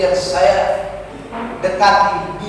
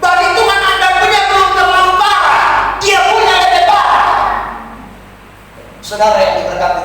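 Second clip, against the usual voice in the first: loud, higher, half-sung preaching over music; full-force preaching; 6 semitones higher, 5 dB louder than usual. Acted. Preacher rousing the congregation to give God glory, half-sung full-force preaching. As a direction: preach it out like a revival call.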